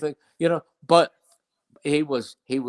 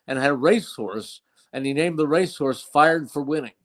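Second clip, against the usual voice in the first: ordinary talk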